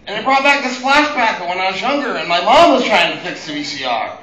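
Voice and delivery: ordinary talk, nothing marked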